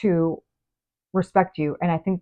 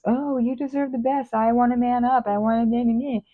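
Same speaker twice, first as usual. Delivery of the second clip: self-absorbed excuse; 6 semitones higher than usual. Acted. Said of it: "they're coming out of this".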